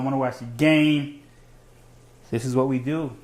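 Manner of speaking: normal talking voice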